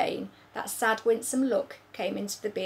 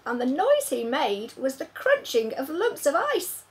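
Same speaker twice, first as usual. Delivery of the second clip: ordinary delivery, nothing marked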